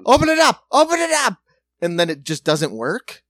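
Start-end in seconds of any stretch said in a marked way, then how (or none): 0.1-1.4 high, loud panicked command; hammed-up urgent shouting, pitched way up; mock frantic shout. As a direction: yell it like a panicking crew. Acts out workers' frantic reversal.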